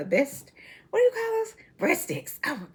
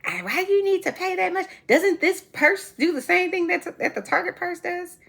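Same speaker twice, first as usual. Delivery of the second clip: higher-pitched mock husband questioning; put-on higher voice for a puzzled husband; imitating husband; 10 semitones higher than usual. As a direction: mimic a husband querying the price, pitched up. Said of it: "He, he like".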